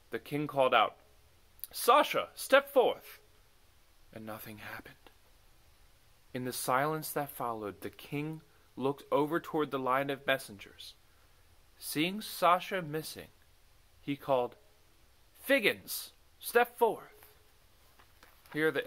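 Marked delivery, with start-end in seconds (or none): 1.7-3.2 loud commanding call across the court; king calling out. Acted. The king calls a messenger forward, loud. 4.1-5.0 whispered narration. A narrator's reading. Dramatic pause after the call. 15.4-17.3 raised royal summons; king calling out. Acted. The king summons Figgins, raised voice.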